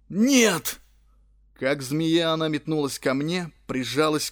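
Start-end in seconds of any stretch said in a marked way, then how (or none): 0.1-0.8 hoarse high cracked cry; cracked croak. A narrator's reading. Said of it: "надтреснуто каркнул".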